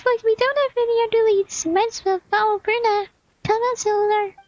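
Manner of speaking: very high, squeaky companion voice; urgent high little voice; high-pitched game companion. Acted. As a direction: pitch it way up like a tiny sidekick.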